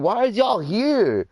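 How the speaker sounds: high, slow mock-dad demand; indignant dad. Retelling dad turning on the cop, indignant and high. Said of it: "my dad turns into him".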